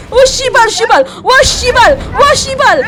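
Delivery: high, loud frightened cursing; shouted, startled expletives; panicked swearing. Real, not acted. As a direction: curse at the top of your voice in fright.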